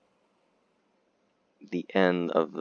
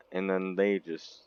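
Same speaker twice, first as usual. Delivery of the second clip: ordinary delivery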